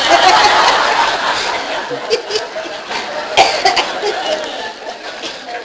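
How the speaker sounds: long, breathless, high laugh; cracking up at his own joke; real laugh. Real, not acted. Preacher laughing at his own Oklahoma joke.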